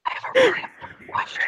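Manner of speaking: quick, voiceless whisper; hushed, hurried whisper. Acted. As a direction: whisper it urgently. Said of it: "Why are you whispering?".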